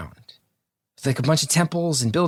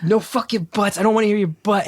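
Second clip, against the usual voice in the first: high-pitched furious no buts; demanding an apology, heated and pitched up; retold angry confrontation; 8 semitones higher than usual. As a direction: tear into him, voice pitched up. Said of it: "I was like".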